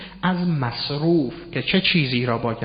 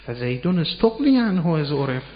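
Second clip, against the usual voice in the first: ordinary talk, nothing marked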